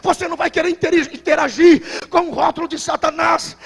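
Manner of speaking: ordinary talk, nothing marked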